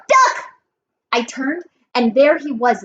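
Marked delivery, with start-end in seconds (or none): 0.1-0.5 very high, sudden yell; frantic high-pitched warning cry. A narrator's reading. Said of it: "Grover yelled".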